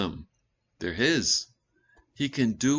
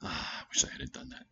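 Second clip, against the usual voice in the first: whispered, soft regretful sigh; regretful God; 7 semitones lower, 9 dB quieter than usual. Retold or imitated. Preacher voicing God's regret as a hushed whisper. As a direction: breathe it out as a rueful whisper. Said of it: "Sorry he formed us".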